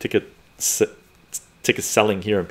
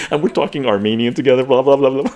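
ordinary talk, nothing marked

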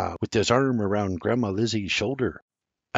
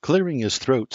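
usual speaking voice

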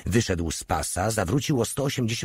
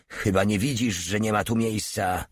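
ordinary delivery, nothing marked

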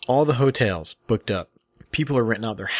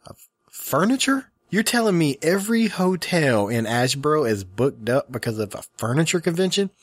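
high-pitched, stammered disbelief; baffled, rising-pitched protest; incredulous; 5 semitones higher than usual. Retold or imitated. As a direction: question it as if it is absurd. Acts out disbelief at hotel clerk.

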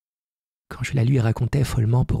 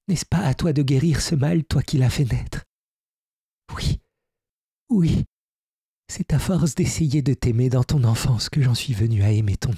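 higher-pitched fairy voice, weary and pleading; fairy Zia; 4 semitones higher than usual. Acted. The fairy confessing to Hermann that she loves his child, higher and pleading.

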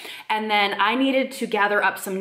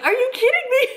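high squeaky disbelief, laughing; laughing mock outrage at the nurses; incredulous; 4 dB louder, faster than usual. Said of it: "And I'm like".

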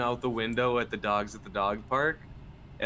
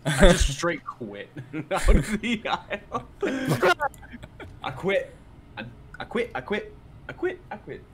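laughing, rising higher on each I quit; hammed-up squeaky I quit, climbing higher; mock quitting; 8 semitones higher, 4 dB louder than usual. Streamer jokingly rage-quitting to chat, laughing high-pitched.

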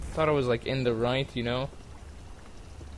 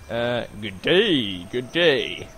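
a hint of a slow Southern drawl on good day; greeting the wedding guests in a put-on Southern accent; mock Southern accent; 3 semitones lower, much slower than usual. Player greets guests in a lightly put-on Southern accent. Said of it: "I need to keep working on my accent".

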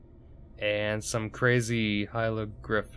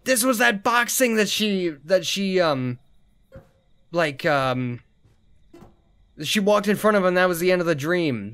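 high, loud, amazed shock; sudden high-pitched astonishment; shocked excitement; 8 semitones higher, 4 dB louder than usual. Real, not acted. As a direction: blurt it out as the dream clicks. Shocked recognition of dream place.

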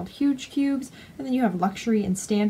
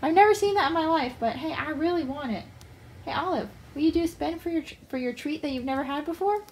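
high, eager little pet voice; squeaky, excited rodent chatter; rat voice; 5 semitones higher than usual. Pretend. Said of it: "They're just like".

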